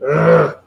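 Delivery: loud groan of dismay; exasperated groan. Real, not acted. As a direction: groan loudly at a bad roll. Reacts to a dice roll.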